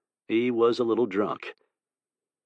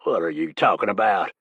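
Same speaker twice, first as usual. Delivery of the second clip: demanding. A narrator's reading. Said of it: "Edgar demanded".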